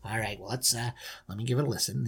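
low, soft, mild concession; easygoing dad. Acted. Dad agrees to give it a listen, low and mild. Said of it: "And he's like".

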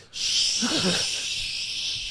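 unvoiced hushing shh; a breathy shush; mock shush. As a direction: imitate her shushing. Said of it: "She went".

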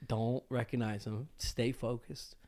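hushed and slow, telling yourself to focus; quiet, slow inner mantra; inner self-talk. Retold or imitated. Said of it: "I'm like".

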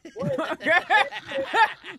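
bursting out laughing; big real laugh. Real, not acted. Laughing at the voice bit.

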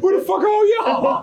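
mock call out. Calling out to the crowd.

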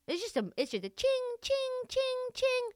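high mock ching ching of a cash register. Explaining NBA money, mimics a cash register's high ching. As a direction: sing out ching like a till.

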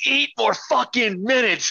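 slow, high, drawn-out jeer; defiant yell. Retold or imitated. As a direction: taunt the crowd with the clock. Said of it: "He's like".

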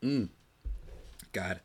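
swallowing water between words; a sip of water, then a sigh; while sipping a drink. Real, not acted. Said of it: "let me take a sip of water".

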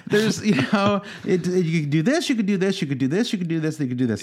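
mock indecisive hedging, starting high and easing down. Host imitating own reaction to chip talk, high dithering. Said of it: "my voice goes up two octaves".

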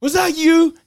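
high, loud barking sergeant; drill sergeant bark. Retold or imitated. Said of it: "He's all".

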